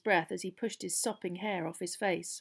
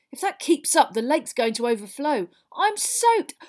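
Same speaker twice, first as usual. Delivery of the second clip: loud, raised-pitch complaint; indignant schoolboy; 5 semitones higher, 8 dB louder than usual. Acted. Drenched boy complaining to his friends, loudly indignant. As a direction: grumble loudly, climbing higher at the end.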